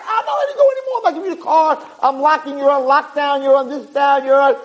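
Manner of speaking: high, squealing mock tirade; hammed-up parental meltdown; freaking-out parent. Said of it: "you freak out".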